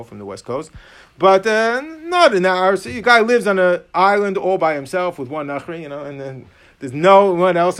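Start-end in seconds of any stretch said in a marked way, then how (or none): none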